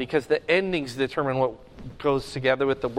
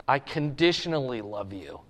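humble admission; 4 semitones lower, 6 dB quieter than usual. Retold or imitated. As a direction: lower and softer, a modest confession. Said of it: "Peter says".

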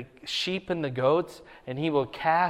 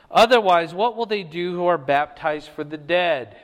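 louder, raised preaching read of the passage; scripture reading; 3 semitones higher, 6 dB louder than usual. Acted. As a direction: proclaim the verses to the congregation. Reads scripture aloud in sermon.

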